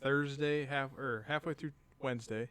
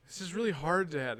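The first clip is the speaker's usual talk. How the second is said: higher, louder mock child whine; kid whining; 5 semitones higher, 5 dB louder than usual. Son complaining to dad mid-workout, imitated higher whine. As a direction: whine it like a worn-out six-year-old. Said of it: "whining and crying".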